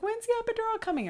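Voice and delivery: high hurried plea for the epidural; frantic plea. Retold or imitated. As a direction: rush it out begging. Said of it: "and so I was like".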